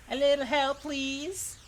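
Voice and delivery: high southern woman's plea; southern accent. Shown as a demonstration. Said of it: "doing a fucking southern accent".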